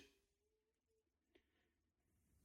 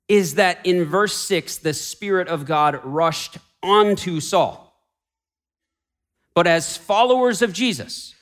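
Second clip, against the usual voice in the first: raised, ringing pulpit voice; full-force preaching; 6 semitones higher than usual. Acted. Pastor proclaiming to the congregation, full-force preaching. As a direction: preach it out to the whole room.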